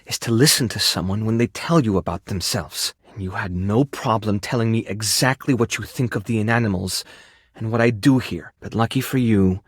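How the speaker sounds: low, measured rebuke; cool and serious, no give; stern. Acted. Stern rebuke, then second chance.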